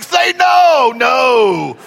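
high, drawn-out protesting cry; alarmed dad. Retold or imitated. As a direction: wail a panicked fatherly refusal. Dad's alarmed reply retold.

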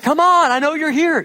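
high, loud pleading yell; mock exasperated yell through the door; exasperated call. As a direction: call out, fed up. Knocking, frustrated at friend's door.

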